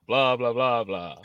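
a hint of a mock Eastern European accent; lightly hammed blah blah blah, Eastern European accent; playful Eastern European accent gibberish; generic Eastern European. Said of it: "Just generic Eastern European".

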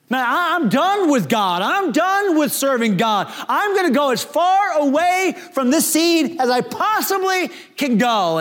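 Jonah giving up. Acted. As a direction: say it like he's walking away for good. Jonah quitting on God.

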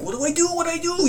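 high-pitched, helpless repeating; high mock-panicked fretting; frantic panic. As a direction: re-enact not knowing what to do, voice way up. Acted frantic dilemma in the night.

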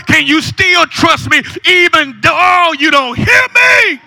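loud, insistent preacher building to a shout; full-force preaching. Real, not acted. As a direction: drive each repeated question home harder.

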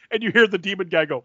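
ordinary talk

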